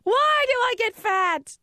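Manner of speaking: high, slow whiny tantrum voice; hammed-up childish whine about cookies; mock tantrum. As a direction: whine like a sulking kid. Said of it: "I'm like".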